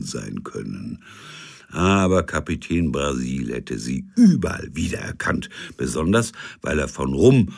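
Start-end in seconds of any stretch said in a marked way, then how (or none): none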